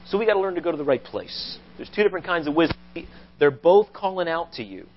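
usual speaking voice

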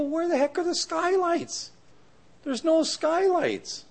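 high, incredulous homeowner voice; exasperated disbelief. Retold or imitated. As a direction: squeak out your disbelief at the missing skylights. Homeowner discovers missing skylights.

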